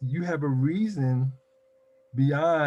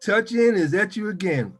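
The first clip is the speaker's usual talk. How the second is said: big show opening; 5 semitones higher, 7 dB louder than usual. Real, not acted. Host greeting the audience at the show's start, booming. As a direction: open the show with a loud hello.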